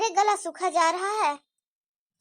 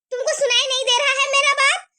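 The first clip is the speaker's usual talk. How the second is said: sharp scold; 5 semitones higher, 4 dB louder than usual. Acted. Mother-in-law scolds again.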